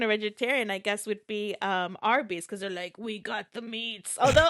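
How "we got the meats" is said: slow, softer imitation of an ad voice; commercial voiceover. Quoting the Arby's ad to co-host, voiceover imitation. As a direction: lightly imitate a commercial tagline read. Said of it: "a new commercial where they're like".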